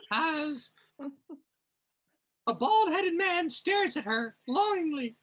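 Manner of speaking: high, reedy frog-puppet voice; Kermit voice. Acted. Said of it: "I'll try Kermit".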